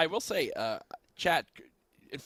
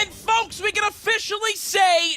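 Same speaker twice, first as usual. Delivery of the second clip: caster call building to a screaming peak; esports caster; 11 semitones higher, 12 dB louder than usual. Pretend. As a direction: hype announcer erupting into a shout. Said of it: "You guys mind if I try something here".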